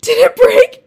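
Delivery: high, loud panicked mom voice; mom's shocked question. Retold or imitated. Imitating her mom's alarmed reaction to the broken jug, high and loud. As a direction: squeal it like a horrified mother.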